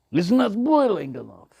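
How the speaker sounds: high, loud put-on whine; hammed-up grumble; mock complaint. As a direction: complain in a silly voice.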